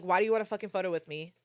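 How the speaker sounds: usual speaking voice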